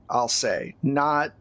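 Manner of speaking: normal talking voice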